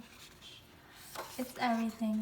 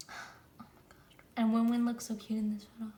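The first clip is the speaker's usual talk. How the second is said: usual speaking voice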